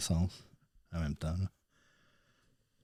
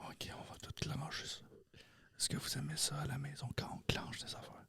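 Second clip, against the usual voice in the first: ASMR whisper; 5 semitones higher, 14 dB quieter than usual. Acted.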